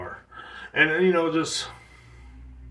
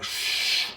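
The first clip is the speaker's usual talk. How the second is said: unvoiced-breath hushing noise, drawn out; urgent shush; 4 dB quieter than usual. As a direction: shush someone down the street. Retells hushing the noisy driver.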